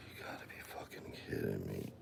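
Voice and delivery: soft whispered curse of disbelief; exasperated whisper. Real, not acted. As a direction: breathe it out, completely fed up. Frustrated muttering to herself.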